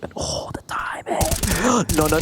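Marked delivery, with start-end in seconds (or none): 0.0-1.2 tense hushed whisper. Real, not acted. Tense moment in match. 1.2-1.9 quick surprised gasp; sharp gasp. Real, not acted. Tense moment in match.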